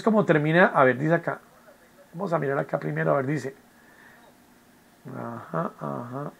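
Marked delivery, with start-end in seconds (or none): 2.1-6.3 very soft, lowered private murmur; quiet, low reading-along mumble; talking to oneself. Real, not acted. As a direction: murmur as you check the instructions. Reading the instructions to herself.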